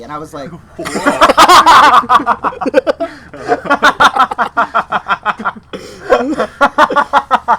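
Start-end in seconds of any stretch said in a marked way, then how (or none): 0.5-6.4 high, loud, drawn-out laughing fit; long loud laugh. Real, not acted. Storyteller cracks up at being called crazy, long loud laugh. Reacting to customer's remark in anecdote.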